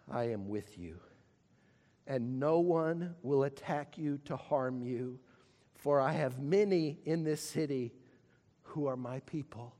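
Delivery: low, soft, intimate voice; tender promise. Acted. God softly promising Paul protection, tender and low. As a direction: speak it gently like a promise.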